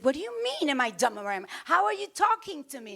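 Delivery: mock-indignant comeback to her husband. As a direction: bristle at the insult. Offended reply to husband.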